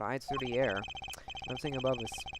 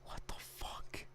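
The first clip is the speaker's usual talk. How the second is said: stunned, drawn-out whisper; whispered disbelief; 14 dB quieter, slower than usual. Real, not acted. Muttered reaction to unreadable text.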